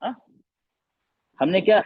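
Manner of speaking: ordinary delivery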